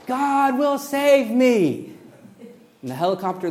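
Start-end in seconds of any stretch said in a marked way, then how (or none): 0.1-1.9 higher, louder, slow stubborn shout; shouting back. Acted. Man on roof shouts up at helicopter, slow stubborn yell. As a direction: drag each word out as a defiant yell. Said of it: "the guy shouts back out".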